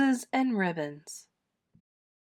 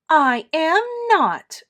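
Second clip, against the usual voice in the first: high, loud, drawn-out defiance; loud childish back talk; indignant retort; 9 semitones higher, 8 dB louder, slower than usual. A narrator's reading. Edith talks back to scolding.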